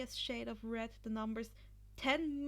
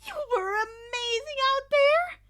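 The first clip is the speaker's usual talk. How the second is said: much higher, louder bubbly cheer; 13 semitones higher, 13 dB louder than usual. Acted. Hatsume cheering Izuku on, excited. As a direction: gush with delight. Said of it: "Hatsume cheered".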